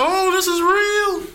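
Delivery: high, slow terrified mock voice; scared audience member. Moviegoer spotting sleepwalker, high scared voice. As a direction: gasp it out in fear.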